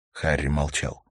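ordinary delivery

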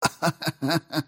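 faint, high bitter chuckle; a barely audible laugh with bitterness in it. A narrator's reading. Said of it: "Дженсен засмеялась. Смех получился едва слышным". (едва слышный горький смешок)

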